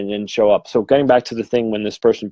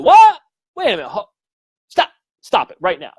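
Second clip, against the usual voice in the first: high, loud "what?" then halting disbelief; shocked interruption; 10 semitones higher, 5 dB louder than usual. Retold or imitated. Medium stopping a client mid-admission, retold shocked outburst. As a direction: cut in, pitch leaping in astonishment.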